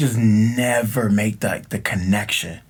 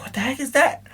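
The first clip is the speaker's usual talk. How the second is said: mock baffled; 10 semitones higher than usual. Mock reading of the E codes.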